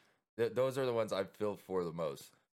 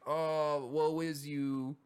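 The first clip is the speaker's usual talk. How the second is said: high, slow, drawn-out fake sympathy; mocking pitying friends of someone in recovery, slow fake sympathy; 5 semitones higher, much slower than usual. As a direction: pour on the pity theatrically. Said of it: "the ones that are just like".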